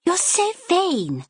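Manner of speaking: high, slow mocking little sister; teasing sister. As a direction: drag it out to needle her.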